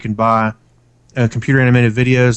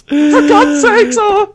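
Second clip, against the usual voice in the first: mock crying; 4 dB louder, much slower than usual. Joins the mock crying bit.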